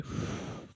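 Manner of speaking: long, audible exhale with no voice; breath out. Shown as a demonstration. Coach demonstrating the breathing exercise, long slow exhale. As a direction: let the air out slowly and fully. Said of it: "four breaths out".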